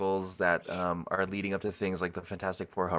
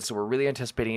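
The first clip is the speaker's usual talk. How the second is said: ordinary talk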